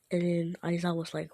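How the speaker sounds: plain reading voice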